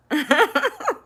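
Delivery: high, loud burst of laughter; real laugh. Real, not acted. Vlogger amused after telling of husband's fufu, laughing aloud.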